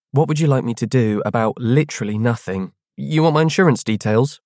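sarcastic schoolgirl. Acted. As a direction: tart know-it-all classmate. Clara voiced in story.

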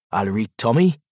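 easy, friendly Geordie hello; Geordie accent. A narrator's reading. Mark greeting his girlfriend's son in a mild Geordie accent. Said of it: "he says in his deep Geordie voice".

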